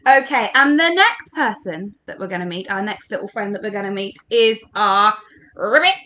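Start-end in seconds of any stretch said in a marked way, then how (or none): 5.5-6.0 rolled, rising ribbit; frog croak. Acted. Introducing the frog to the children, frog croak. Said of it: "our frog".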